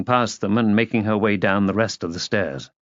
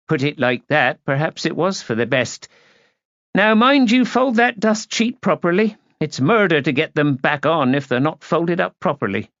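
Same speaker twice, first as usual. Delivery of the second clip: higher-pitched fussy servant; brisk housekeeper; 6 semitones higher than usual. A narrator's reading. Housekeeper warning helper about the dust sheet, fussy. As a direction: instruct the helper firmly.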